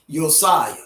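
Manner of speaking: Josiah as yo-SYE-uh; common pronunciation. Shown as a demonstration. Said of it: "commonly called".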